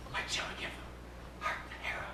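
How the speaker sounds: soft unvoiced whisper, barely there; nervous whispered question in the dark; frightened whisper. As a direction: breathe it so nobody inside hears. Frightened boys outside dark house.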